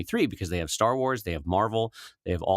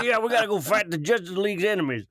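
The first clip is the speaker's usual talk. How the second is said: much higher Southern trucker voice; booming warrior king; 9 semitones higher than usual. Retold or imitated. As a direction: keep up the gritty hero act. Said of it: "Aquaman sounded like".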